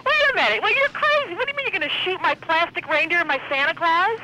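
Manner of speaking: very high, agitated protest; real flustered indignation; incredulous outrage. Real, not acted. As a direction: sputter in disbelief at a crazy threat. Told her reindeer will be shot.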